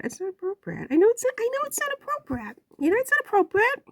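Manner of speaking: high, fast squeaky self-scold; mock scolding self. Real, not acted. Vlogger scolding herself for swearing, high and fast.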